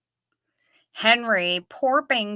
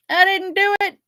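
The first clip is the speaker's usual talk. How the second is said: high, put-on voice of a guilty culprit; mock innocent denial; 11 semitones higher, 4 dB louder than usual. Pretending to be the one who took Rick's shirt, high mock innocence. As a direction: play the fake-innocent culprit.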